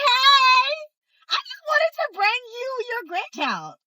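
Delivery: high, over-bright mock innocent hello; fake cheerful greeting. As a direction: play it sweet like you just dropped by. Her over-bright greeting to his mom.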